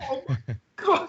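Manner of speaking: high-pitched, stifled starstruck gasp of words; quiet freak-out. Retold or imitated. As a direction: act out a contained freak-out, breaking into a laugh. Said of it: "I was freaking out very quietly".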